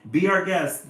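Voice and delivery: animals' reply. A narrator's reading. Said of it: "the animals say".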